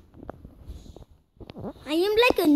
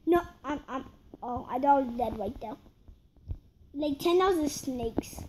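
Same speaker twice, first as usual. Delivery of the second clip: ordinary talk, nothing marked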